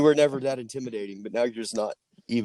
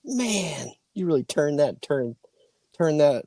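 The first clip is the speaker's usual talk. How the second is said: higher, slow, fawning; mock flirty admirer; 7 semitones higher, much slower than usual. Fake admirer flirts with biker, slow and high. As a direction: fawn over the rider teasingly.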